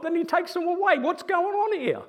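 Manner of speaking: high-pitched, incredulous questioning of God; retold bewildered complaint, pitch jumping up; indignant protest. Young believer questioning God over missionaries' deaths, indignant.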